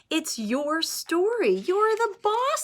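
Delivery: put-on older sister urging her brother, high and slow; big sister voice. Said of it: "says his sister".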